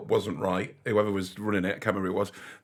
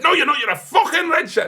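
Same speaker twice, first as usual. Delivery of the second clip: high, louder mock shout; imitating an angry fan; 6 dB louder than usual. Everton fan refusing to believe him, mock angry shout. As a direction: roar it back as the furious fan. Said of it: "He went".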